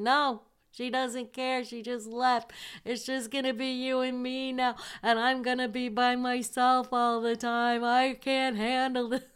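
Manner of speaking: high, wailing upset voice, lightly acted; mock her panic in a high whine; distraught roommate. Roommate Cindy panicking about being left alone, high wail.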